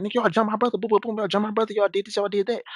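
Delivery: high-pitched mock accusing rant; imitated crazy talk. Neighbor phoning guys about his jumped brother, imitated crazy talk. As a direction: mimic a worked-up man rattling off accusations. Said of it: "he talking crazy".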